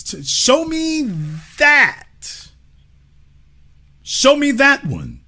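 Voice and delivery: high, slow, pointed demand; demanding challenge. Real, not acted. Host pressing his guest, high and drawn out, show me that.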